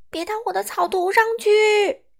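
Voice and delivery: high, loud panicked warning; acted urgent storybook cry; shouting. A narrator's reading. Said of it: "米歇尔还没喊出口".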